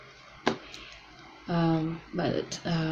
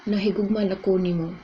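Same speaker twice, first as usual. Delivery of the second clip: "Nahigugma nako nimo" said quickly and casually; quick everyday "Nahigugma nako nimo"; fast; 2 semitones higher, faster than usual. Shown as a demonstration.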